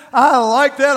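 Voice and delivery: loud, punchy I like that; emphatic approval. Retold or imitated. Mocking the picky listener, loudly approving. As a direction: stamp the approval. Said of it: "and they said, Okay".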